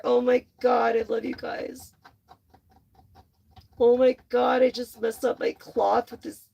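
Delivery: normal talking voice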